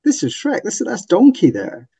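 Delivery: a light mock exclaim of discovery; amused recognition. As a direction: spot the Shrek parallels with delight. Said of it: "in a post-Shrek world going".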